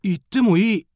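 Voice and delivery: high, loud eager question; eager surprise. Acted. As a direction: ask it, thrilled and surprised.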